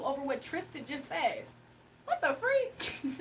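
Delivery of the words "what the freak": very high, drawn-out, slow disbelief; stunned. Real, not acted. Moved by Tristan's chat message, stunned. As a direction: let it stretch out in amazement. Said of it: "reacting to chat".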